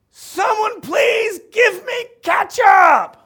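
full-blast, drawn-out high shout; boy hollering at the top of his lungs; frustrated yell. Acted. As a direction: explode after being ignored, stretching every word. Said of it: "rude of you to yell out".

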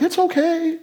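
high mock excuse, nearly squeaky; a cheeky wheedling excuse voiced high. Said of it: "we're not gonna just be like".

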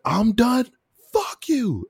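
high, loud mock yell; retold drunken yell. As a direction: shout it back like a drunk picking a fight. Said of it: "he just yells at me".

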